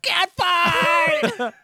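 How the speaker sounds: high-pitched, full-throated imitation scream; Joey Styles scream. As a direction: holler it like an over-the-top wrestling announcer. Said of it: "Joey Styles screams out".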